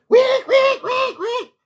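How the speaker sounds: very high squeaky mock squeals; imitated guinea pig squeaks. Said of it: "He starts screaming".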